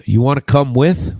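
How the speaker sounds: light Minnesota lilt, rising on with; a mild Minnesota accent, ending up on with; Minnesota phrasing. Retold or imitated. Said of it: "would say things like".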